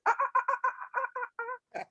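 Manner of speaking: high yelping hyena laugh; hyena cackle. Acted. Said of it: "Hyena said".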